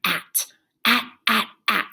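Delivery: "at" repeated in short, separate beats; isolated ending part. Shown as a demonstration. Teacher sounds out what's left, "at" in separate beats. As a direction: chant "at" crisply, a gap after each. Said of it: "then what's left?".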